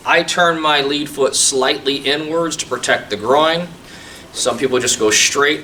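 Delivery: normal talking voice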